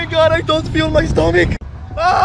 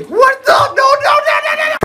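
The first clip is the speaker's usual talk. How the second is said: much higher, frantic no's; panicked shriek; 13 semitones higher than usual. Acted. Panicked at the roach.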